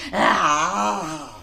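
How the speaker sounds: scared cry. Acted. Shark opens its jaws.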